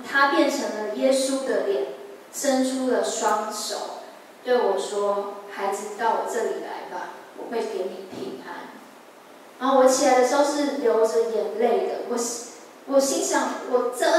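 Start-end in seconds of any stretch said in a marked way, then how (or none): 5.5-8.7 put-on softer, tender call to a child; quiet, reassuring divine promise; gentle comforting voice. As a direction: soothe her like a loving father. Jesus speaks in dream.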